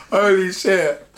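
high laughter, then gasped words; laughing hard. Real, not acted. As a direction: laugh it out, can barely speak. Laughing at the story.

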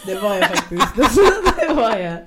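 real laughter, owning it; laughing. Real, not acted. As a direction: laugh out the confession. Admits being cocky, laughing.

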